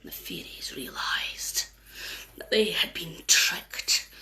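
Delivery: slow, low whisper; whispering storyteller; ominous whisper. A narrator's reading. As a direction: hush it slowly, full of dread.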